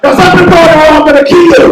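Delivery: loud high threat; furious dad yell. Retold or imitated. Dad yelling at the son locked in the toilet, loud. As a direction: scream it through the door.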